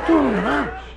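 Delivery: loud, high, shocked question; incredulous outburst; outraged disbelief. Acted. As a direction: blurt it out at an outrageous price. Man is outraged at price.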